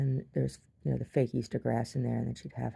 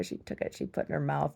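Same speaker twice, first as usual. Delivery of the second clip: ordinary talk, nothing marked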